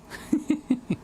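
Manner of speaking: a quick soft high chuckle; high breathy laugh. Real, not acted. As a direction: laugh lightly at yourself. Laughs at own disclaimer.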